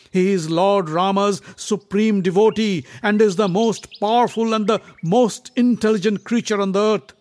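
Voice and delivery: loud, proud, scolding prince; indignant. Acted. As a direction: scold him, boast of brother. Bhima indignant, boasting.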